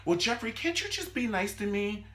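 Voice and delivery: high-pitched imitation of a needy girlfriend; mock girlish pleading. Girl asking Jeffrey for affection, mock girlish pleading.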